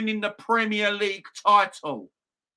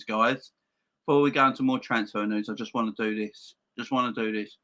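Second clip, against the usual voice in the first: ordinary delivery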